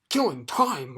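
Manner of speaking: loud, furious bark; furious roar. Acted. Watchdog outraged at Milo, loud furious roar. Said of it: "roared the dog so furiously".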